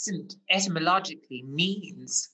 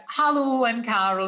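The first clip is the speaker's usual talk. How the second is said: loud, higher-pitched caller's hello; phone-in caller; 4 semitones higher, 4 dB louder than usual. Retold or imitated. Quiz caller ringing the show, put-on accent. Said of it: "Judy Finnegan does that too".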